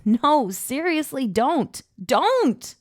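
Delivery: high, loud mock-stern warning. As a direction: try to sound stern, half laughing. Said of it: "make my voice as stern as possible".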